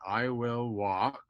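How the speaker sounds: imitating Dad reading slowly, word by word; slow halting reading. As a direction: stretch each word as if working out the writing. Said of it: "I thought he couldn't read".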